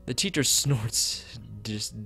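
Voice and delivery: ordinary delivery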